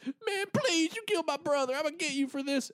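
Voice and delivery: very high, strained pleading; avenging brother, high and strained; frantic grief. Retold or imitated. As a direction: beg and threaten at once. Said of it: "the Blood Brother's like".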